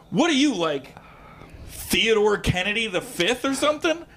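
high-pitched mock-amazed question; incredulous teasing guess. Retold teasing guess from stage.

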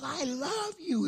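put-on high, slow little boy's voice; tender child voice. Grandson telling grandpa he loves him, high slow child voice. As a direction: say it softly like a five-year-old.

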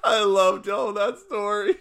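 very high, wheezing laugh into words; high laughter. Real, not acted. Storyteller cackling about loving to tell that story. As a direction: cackle then say it. Cracking up.

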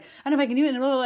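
high, loud mimicry of a client; imitated anxious babbling. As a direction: parody someone blurting out self-doubt. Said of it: "not word vomiting, but".

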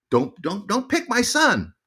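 high, drawn-out imitation of mom; pleading mom. Mom warning off baseball scouts, high insistent plea. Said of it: "I would hear my mom say".

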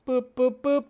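high, evenly spaced little boops; sing-song beeping noise; playful boops. Real, not acted. Streamer filling time before the next topic, playful high boops.

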